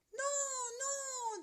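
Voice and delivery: galette refusing. Acted. Galette to the bear, tiny high refusal. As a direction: squeak it small and high. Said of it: "dit la galette".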